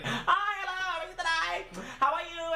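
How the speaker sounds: high-pitched, exaggerated hostess greeting; Chinese lady impression. Chinese lady greets arriving diners, imitated high and perky. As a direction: imitate her in a high singsong. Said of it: "That's exactly how she sounded".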